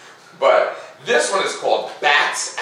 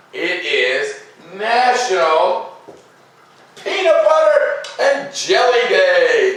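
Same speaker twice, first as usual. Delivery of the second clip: high, slow drawn-out announcement with a laugh; a game show reveal, stretched out and laughing; grand reveal; 10 semitones higher, 4 dB louder, slower than usual. Acted. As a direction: unveil the best one for last, laughing. Big reveal saved for last.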